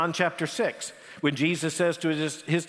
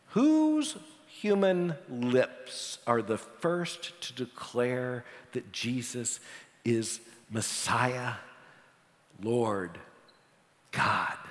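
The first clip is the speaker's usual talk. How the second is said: slow and solemn; 5 semitones lower, 4 dB quieter, much slower than usual. Real, not acted. Preacher slows for climax.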